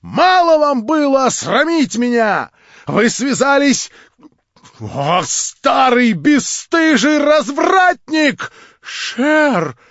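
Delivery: громко и высоко, с яростью, врастяжку; обрушь на него весь гнев и стыд; гневный крик. Acted. Варвара кричит в гневе. (гневный крик с упрёком)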